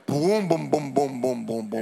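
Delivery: imitated truck rumble. Imitating dad's diesel truck.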